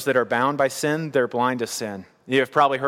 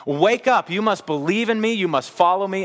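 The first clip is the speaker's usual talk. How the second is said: Jesus pleading with religious people, urgent raised cry; 6 semitones higher than usual. Retold or imitated. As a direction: plead with them to wake up. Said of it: "he is pleading with these religious people".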